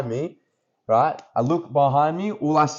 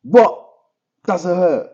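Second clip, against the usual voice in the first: taunting; 4 semitones higher, 4 dB louder than usual. Retold or imitated. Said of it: "and you're like".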